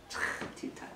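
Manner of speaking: unvoiced whisper. Real, not acted. Preacher softly stressing tea time, whispered. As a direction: let it slip as a hushed aside. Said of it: "whispered aside".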